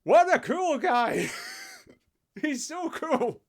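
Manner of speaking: high, drawn-out gushing over a cool guy; mock admiration. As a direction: gush loudly, sarcastically impressed. Mocking cheer at list lines.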